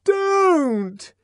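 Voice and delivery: frightened woman crying out while being shaken; tremulous protest. Acted. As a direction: plead through the shaking. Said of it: "she protested in a voice made grotesquely tremulous".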